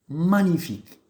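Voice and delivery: high, loud exclaimed "Magnifique"; one-word exclamation. Shown as a demonstration. Teacher exclaiming a one-word example to pupils. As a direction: burst out "Magnifique" in wonder. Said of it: "Exemple, génial".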